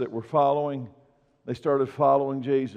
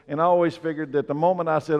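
ordinary talk, nothing marked

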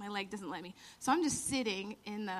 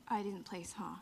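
hushed fake-sad whisper; whispered tease; 4 semitones lower, 6 dB quieter than usual. Whispering a fake confession to Jeremy. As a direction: whisper it like a sly prank. Teasing April Fools fake news.

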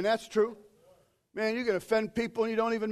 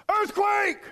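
high, sudden mock-urgent shout; exclaimed alarm; 10 semitones higher than usual. Jonathan's urgent text read out, exclaimed high shout.

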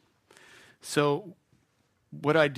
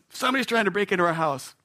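imitating wife on phone; 6 semitones higher, 5 dB louder, faster than usual. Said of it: "Lisa's on the phone calling the sheriff".

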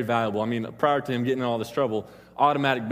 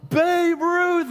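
high, loud mock scream of shock; drawn-out high yell, acted alarm; freaked-out shout; 5 dB louder than usual. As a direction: imitate kids yelling out in stunned disbelief. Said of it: "and freak out".

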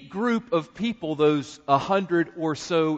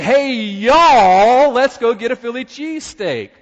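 exaggerated Southern accent; 4 semitones higher, 8 dB louder than usual. Friends teasing the preacher in a loud Southern accent. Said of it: "they jumped on that and exaggerated".

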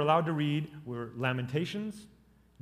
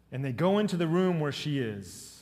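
normal talking voice

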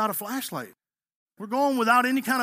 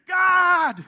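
a loud, higher exasperated shout; exasperated cry; 9 semitones higher, 8 dB louder than usual. Retold or imitated. Disappointed believer crying out to God, loud and exasperated.

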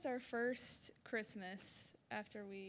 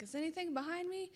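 anxious check; 8 semitones higher, faster than usual. Retold or imitated. Checking while reversing.

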